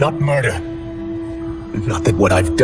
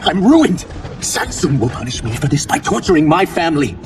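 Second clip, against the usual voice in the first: distraught, raised voice; anguished outburst; 7 semitones higher than usual. Acted. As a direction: cry out in desperate dread for your family. Failed jailer fears for family.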